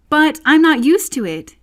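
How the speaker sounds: high, loud pleading cry; piteous plea. A narrator's reading. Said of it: "pleaded poor Alice in a piteous tone".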